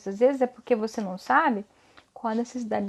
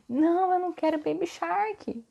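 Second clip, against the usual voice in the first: put-on whiny baby refusing; 5 semitones higher than usual.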